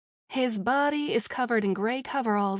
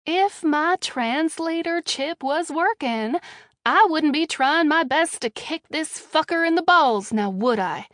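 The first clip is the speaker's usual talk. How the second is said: sarcastic; 5 semitones higher, 6 dB louder than usual. Acted. Captive mocks Jessie about her translator chip, sarcastic. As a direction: lay the sarcasm on thick. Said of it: "I respond with sarcasm dripping".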